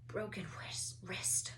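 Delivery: whispered mutter. A narrator's reading. Madame Hooch mutters over Neville's wrist, whispered. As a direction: murmur it to herself. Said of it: "Harry heard her mutter".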